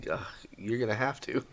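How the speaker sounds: whispered aside; whispering. Real, not acted. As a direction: breathe it out under your breath. Quiet aside.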